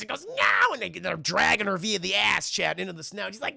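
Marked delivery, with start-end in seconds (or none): 0.3-0.7 high, loud mock scream; hammed-up cry of a victim; mock terror. Linda dragged into the snow.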